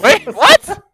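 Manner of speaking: high, loud, laughing disbelief; shocked. Real, not acted. Shocked reaction.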